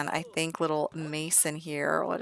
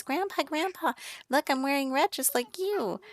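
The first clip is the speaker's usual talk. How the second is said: put-on much higher, eager little-kid voice; excited toddler voice; 10 semitones higher than usual. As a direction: squeak it out like a toddler showing off to grandpa.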